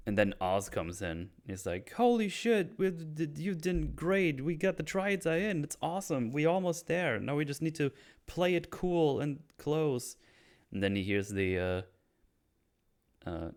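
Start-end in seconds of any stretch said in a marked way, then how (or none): 1.9-10.2 higher-pitched, thrilled voice, acting it out; excited praise. Oz praises Vic after the Triads deal, excited. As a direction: play a pleased boss hyping up his guy.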